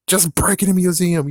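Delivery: high, loud incredulous exclamation; loud, high-pitched protest; indignant outburst. Real, not acted. Indignant rant about monster's method.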